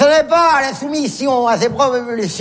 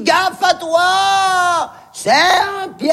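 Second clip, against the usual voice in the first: high, slow, stretched-out call; drawn-out distant holler; warning from above; 6 semitones higher, much slower than usual. Retold or imitated. President's warning called from above.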